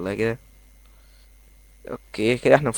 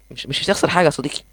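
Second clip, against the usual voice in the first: usual speaking voice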